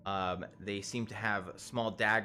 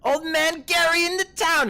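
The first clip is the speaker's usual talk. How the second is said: much higher, much louder bellow; shouted retake; 13 dB louder than usual. Acted. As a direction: roar the fixed line out. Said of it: "Fuck, damn it".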